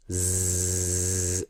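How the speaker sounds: "Zzz" held long as a steady electric buzz. Shown as a demonstration. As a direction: drag out "Zzz" like an electric shaver. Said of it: "Like electric kind of sound".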